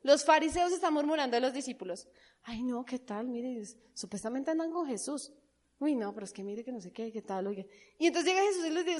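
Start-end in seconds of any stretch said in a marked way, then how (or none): 2.4-7.7 gossiping murmur. Retold or imitated. Pharisees gossiping about the disciples.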